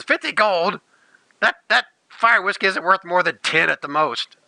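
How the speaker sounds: high, scoffing disbelief; indignant dwarf. Acted. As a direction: scoff at the price. Dwarf balks at the price.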